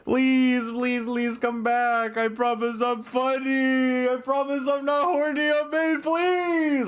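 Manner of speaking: high, pleading whine, slowed; mock begging. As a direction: beg like it's life or death, hammed-up. Begging viewers to come back.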